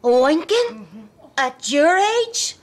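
loud, slow, scandalised disbelief; appalled outburst. Real, not acted. Daughter scandalised that her widowed father winks at a woman, loud appalled. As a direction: spit the words out in outrage.

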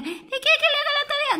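put-on high eager child voice; eager little turtle offering to help her mother; little tortoise voice. Little turtle answering mama, high and eager.

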